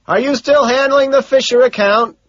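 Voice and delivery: high, sustained yell; loud shouted question; shouting back. A narrator's reading. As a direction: holler the question over the din. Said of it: "I shout back".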